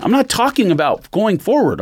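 usual speaking voice

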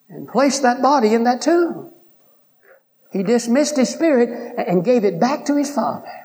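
a preacher lifting the crowd; full-force preaching. Acted. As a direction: preach it high over the congregation. Preaching climax on death.

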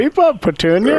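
put-on high old man voice. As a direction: nag the horse like an old man. Mimicking the old man call.

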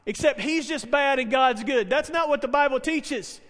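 usual speaking voice

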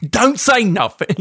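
high, slow attempted Cockney; not-quite-there Cockney impression; Michael Caine. Co-host attempting the same line, strained Cockney impression. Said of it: "Oh, I can't get it".

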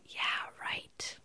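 voiceless, sneering whisper; whispered scoff. Retold or imitated. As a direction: brush her off in a dry whisper. Gossip lady dismissing her story.

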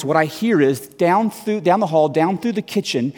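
normal talking voice

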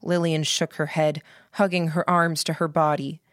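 ordinary delivery

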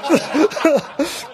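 short high laugh mid-quote; real laugh. Real, not acted. Quoting the minister's joke, breaking into laughter. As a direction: laugh through the punchline.

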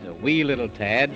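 usual speaking voice

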